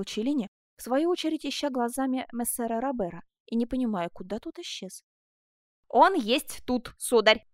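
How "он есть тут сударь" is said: loud, slow, laboured call; heavy, drawn-out shout to the master; shouted reply. A narrator's reading. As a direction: call it out word by word. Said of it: "крикнул Герман".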